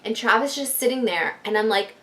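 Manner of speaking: ordinary talk, nothing marked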